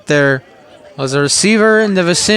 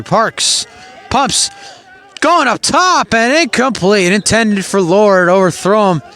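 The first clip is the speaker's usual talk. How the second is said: excited play call; 4 semitones higher, slower than usual. Acted.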